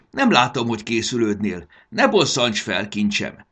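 put-on grandma shouting at a dawdling boy, impatient. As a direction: scold him loudly, patience running out. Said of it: "Nagymama egyre türelmetlenebből kiabált".

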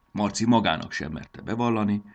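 plain reading voice

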